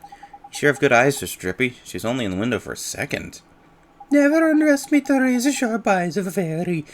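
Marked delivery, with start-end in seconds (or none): 4.1-6.8 higher, loud, excitable little sidekick; high cheeky fairy voice. Acted.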